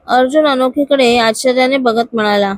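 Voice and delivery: ordinary delivery, nothing marked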